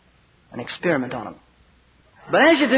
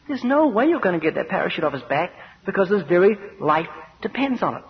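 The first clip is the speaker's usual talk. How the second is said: ordinary talk, nothing marked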